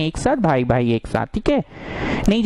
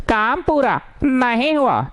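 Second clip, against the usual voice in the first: higher, swooping singsong on each half; drilled catchphrase; 6 semitones higher, slower than usual. Shown as a demonstration. Teacher drilling the rule into students, in exaggerated singsong. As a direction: chant the rule in two big falling swoops.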